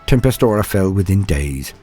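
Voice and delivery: ordinary delivery, nothing marked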